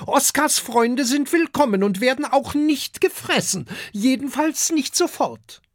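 very high-pitched dragon-mother voice; female dragon. A narrator's reading. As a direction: welcome guests in a high she-dragon voice. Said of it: "sagt die Fressdrachin". (hohe Drachinnenstimme)

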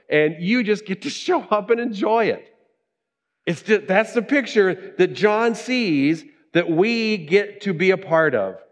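high, driving sermon delivery; impassioned preaching. Real, not acted. Preacher assures congregation Jesus makes them good enough, impassioned preaching. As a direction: preach it with rising fervor.

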